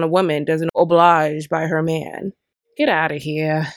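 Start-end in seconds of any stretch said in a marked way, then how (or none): none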